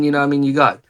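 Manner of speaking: usual speaking voice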